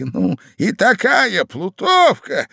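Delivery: high, loud, drawn-out relish; slow, high-pitched old husband; old man. A narrator's reading. Old man going on about the rogue, high and slow. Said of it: "продолжал старик".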